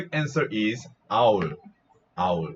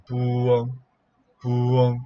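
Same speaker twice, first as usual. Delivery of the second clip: measured Korean owl hoot; owl hoot imitation; much faster than usual. Shown as a demonstration. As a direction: demonstrate the owl's call. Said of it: "this is our sound".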